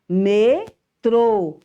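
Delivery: Metrô said loud, closed trô; model word. Shown as a demonstration. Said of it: "Onde é o acento?".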